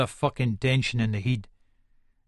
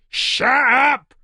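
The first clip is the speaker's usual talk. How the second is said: high, loud angry bark; furious shout; 12 semitones higher, 8 dB louder than usual. Acted. Hard man roaring at the screaming intruder to shut up. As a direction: shut him up with a roar. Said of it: "I fucking shout at him".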